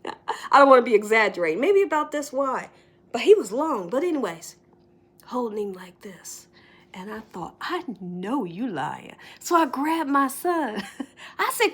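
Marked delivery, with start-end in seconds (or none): 5.2-9.1 hushed whisper, breathy and secret. Real, not acted. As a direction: whisper it like you're spying on a neighbour. Whispering retelling secret spying.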